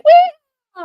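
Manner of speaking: hammed-up gleeful shriek; mock wee. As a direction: squeal like a giddy kid playing. Mocking kids shooting guns for fun.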